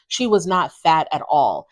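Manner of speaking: ordinary delivery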